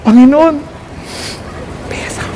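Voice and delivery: trailing off to a bashful near-silence; shy prayer. Retold or imitated. Filipino believer embarrassed to ask God for a spouse, fading shyly. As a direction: ask timidly, too embarrassed to finish.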